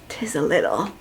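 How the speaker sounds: whispered giggly reply; drunk giggle. Retold or imitated. Drunk sister laughs off the worry, whispered giggle. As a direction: giggle it out, tipsy.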